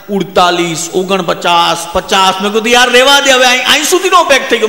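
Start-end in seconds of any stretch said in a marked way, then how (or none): 2.7-4.7 louder, higher plea to stop; loud plea to a pushy host; pleading, too full. Retold or imitated. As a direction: beg him off, stuffed full. Stuffed friend begging to stop.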